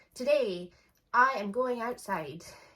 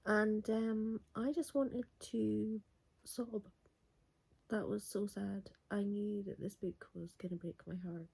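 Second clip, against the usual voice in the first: late-night whisper; 6 dB quieter than usual. Real, not acted. Updating viewers in bed at night, whispering. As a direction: keep it hushed so nobody wakes.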